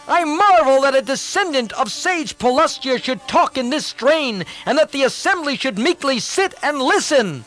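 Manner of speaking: put-on high, indignant young prince; bursting out impatiently. Indrajit scorning his uncle before the assembly, indignant and high. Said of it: "lost patience and burst out".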